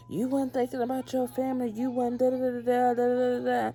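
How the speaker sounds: high, fast mocking nag; mimicked scolding cop. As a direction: imitate a cop's lecture, rattled off high. Mimics officer's nagging scolding.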